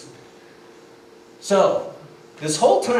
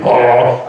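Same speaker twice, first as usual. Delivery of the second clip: loud, lower growled "oh"; imitated singer's growl; 5 semitones lower, 8 dB louder than usual. Mark's vocals in the helmet mid-fall, loud growl. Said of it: "Mark's going".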